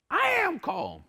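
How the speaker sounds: mock insistence. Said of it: "She's like".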